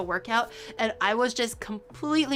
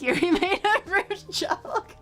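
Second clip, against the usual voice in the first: slow, laughing through the words; amused mock entry; laughing mock note; 9 semitones higher, slower than usual. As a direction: giggle as you dictate it. Mocking chat note, laughing.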